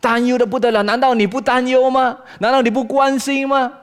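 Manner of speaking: loud, high challenging questions; exasperated demand for an answer; reproaching God. Acted. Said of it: "有点像在责问神".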